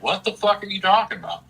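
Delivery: high, fast disbelieving retort; incredulous outburst. Retold or imitated. To his father about the nursing home, a quick high incredulous outburst. Stunned reply to crying dad.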